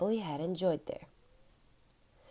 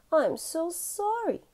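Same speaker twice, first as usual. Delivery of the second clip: much higher, slow pleading apology; a guilty child asking forgiveness; pleading sorry; 10 semitones higher than usual. Acted. Albie apologises again.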